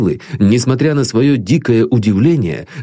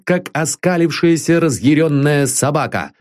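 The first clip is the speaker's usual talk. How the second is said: ordinary delivery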